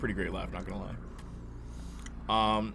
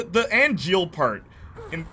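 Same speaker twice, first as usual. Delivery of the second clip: ordinary talk, nothing marked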